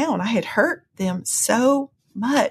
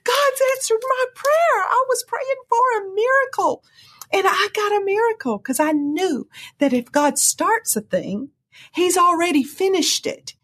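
excited joy; 12 semitones higher than usual. Real, not acted.

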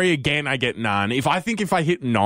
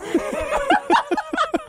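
high burst of laughter. Real, not acted. Big laugh.

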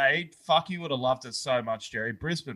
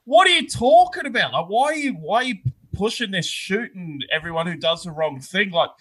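higher, louder outraged disbelief; incredulous yell; 7 semitones higher, 6 dB louder than usual. Retold or imitated. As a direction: shout it back at dad in disbelief. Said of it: "And I'm like".

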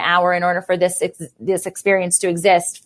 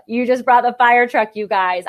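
ordinary delivery

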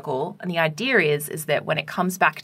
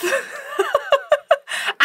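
real laugh breaking out, high and giggly; laughing at the memory of Bill; high burst of laughter. Real, not acted. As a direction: let a giggle escape mid-story. Laughing retelling cheeky student moment.

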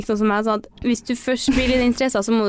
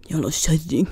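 hvisket mumlet skjellsord gjennom veggen; hvisket fornærmelse; 8 semitones lower, slower than usual. Retold or imitated. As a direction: hvisk det lavt og bittert. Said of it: "hører jeg bare sånn". (imitert hvisket «Jævla kjerring»)

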